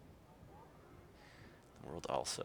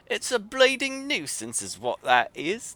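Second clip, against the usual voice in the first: put-on thick Yorkshire accent, much higher and louder, grumbling; a cross Yorkshire artificer grumbling; Yorkshire grumble; 14 semitones higher, 10 dB louder than usual. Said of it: "grumbled in a thick Yorkshire accent".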